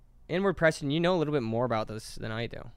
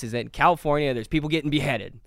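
normal talking voice

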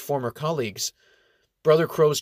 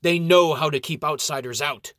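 shooting back; 7 semitones higher, 5 dB louder than usual. A narrator's reading. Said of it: "shoots back".

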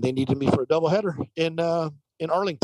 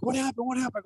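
high, quick mock alarm; high-pitched imitation of excited relatives; excited, freaking out; 8 semitones higher, 5 dB quieter than usual. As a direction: retell their excited questions in a raised pitch. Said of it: "they were freaking out".

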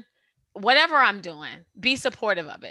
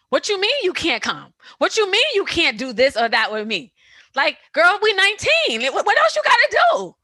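loud, high-pitched mock protest, building higher; indignant younger self; 9 semitones higher, 9 dB louder than usual. Nineteen-year-old to a friend who can't come, indignant mock protest. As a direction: act out outraged disbelief, getting higher and louder.

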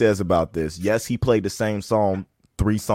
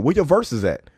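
ordinary talk

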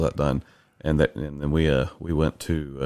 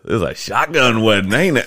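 put-on drunk judge; 5 semitones higher, 4 dB louder than usual. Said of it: "he goes".

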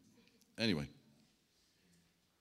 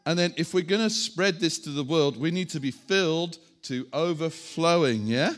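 normal talking voice

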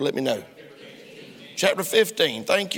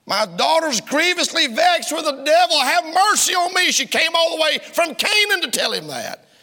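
high, loud fervent preacher voice, building up; full-force preaching; 12 semitones higher, 5 dB louder than usual. Acted. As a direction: proclaim the scripture like a revival preacher. Preaching the woman's plea.

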